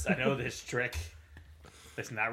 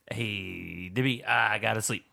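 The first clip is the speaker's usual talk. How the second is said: normal talking voice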